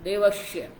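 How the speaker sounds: देवस्य as a low "devashya"; retroflex for dental. Retold or imitated. Teacher showing how others err, देवस्य with "sh". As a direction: curl the tongue back, making देवस्य "devashya". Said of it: "दंत्य के स्थान पर वो मूर्धा बोलते हैं".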